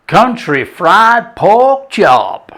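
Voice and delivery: slow, drawn-out mock Southern accent. Cooking host announcing the dish, in a mock Southern accent. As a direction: drawl it in a hammed-up Southern accent. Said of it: "I'm not very good with this southern accent".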